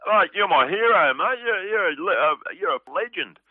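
high, loud, adoring fan voice, mocking them; gushing fan. Comedian imitating fans gushing after a show, high and loud. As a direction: ham up a starstruck punter gushing over him. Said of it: "people go".

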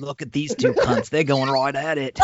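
slow, laughing Aussie commentary; mock Australian. Cohost adds to the bit, slow laughing Aussie voice. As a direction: play the Crocodile Hunter watching a fight. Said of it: "crocodile hunter".

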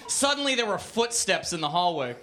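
ordinary delivery, nothing marked

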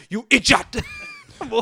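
imitated Nigerian mom's scolding; Nigerian accent. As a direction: mimic a mother's Nigerian-accented insult. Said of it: "it just sounded the same".

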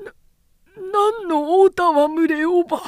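cornered official stammering in fear at the minister; terrified stammer. Acted. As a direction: gasp out the denial in panic, voice jumping up. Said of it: "語尾はかすれて、歯の根も合わない".

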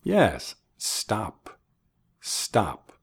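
normal talking voice

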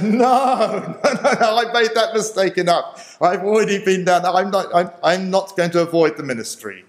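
replaying his own firm refusal; emphatic retold refusal. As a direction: push back hard but half laughing.

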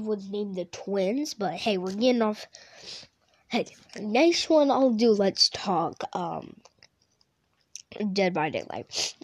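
ordinary talk

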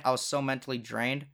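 ordinary talk, nothing marked

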